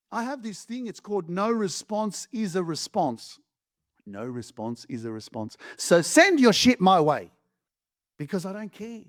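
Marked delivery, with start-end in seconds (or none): 4.1-5.6 much lower and softer; a deep, quiet echo of the line; low, soft repeat. Shown as a demonstration. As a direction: drop it low and soft so it sinks in. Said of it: "repeated lower and softer".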